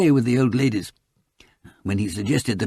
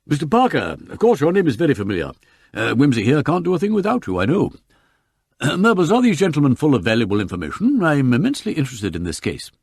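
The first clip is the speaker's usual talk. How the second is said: quick, higher-pitched, effusive barrister; eager learned counsel; 5 semitones higher, 4 dB louder than usual. Acted. Counsel greeting a new acquaintance, eager and effusive. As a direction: rattle on with keen interest.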